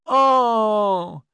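put-on high, drawn-out child's groan; disappointed child. A narrator's reading. Daughters told of no presents, high disappointed cry. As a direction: draw it out high like a let-down kid.

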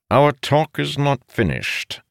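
slow, high, insistent command; stern. A narrator's reading. Father calls his son back to talk, stern. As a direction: lay down the law firmly, slowly. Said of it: "Aranil said sternly".

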